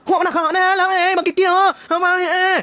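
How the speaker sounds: high, squeaky mock Padang babble; mock Padang accent. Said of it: "Gambarannya kayak gitulah". (tiruan logat Padang yang panik dan tinggi)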